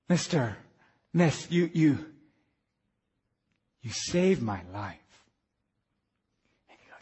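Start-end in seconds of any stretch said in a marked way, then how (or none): 0.1-5.0 weak, low, halting gasps; out of breath. Acted. Rescued swimmer to his rescuer, acted breathless. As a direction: stammer it out between breaths. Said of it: "trying to catch your breath".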